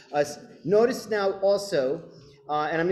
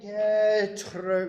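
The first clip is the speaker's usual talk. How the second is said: stretched speaking; 6 semitones higher, much slower than usual. Shown as a demonstration. Director leading choir in speaking the phrase, "Zolan getr-" stretched.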